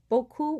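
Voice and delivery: BEAUCOUP said "bo-koo", no P; silent final P. Shown as a demonstration. Teacher giving an example word, silent final P. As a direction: end beaucoup on "oo", P dropped. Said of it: "we don't hear the P".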